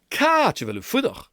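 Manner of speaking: lively Gaelic "Catch a veil u fudach". Shown as a demonstration. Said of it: "more dynamic kind of quality".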